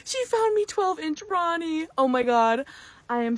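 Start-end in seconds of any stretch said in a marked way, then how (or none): 0.1-1.9 high squealing delight; excited squeal. Real, not acted. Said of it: "I'm so excited".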